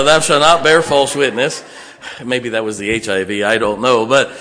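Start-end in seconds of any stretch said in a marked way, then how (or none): 0.0-1.6 booming KJV verse; loud scripture reading. Acted. Preacher reading the commandments aloud to his congregation, loud. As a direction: proclaim the commandment to the hall.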